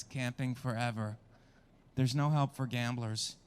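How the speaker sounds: normal talking voice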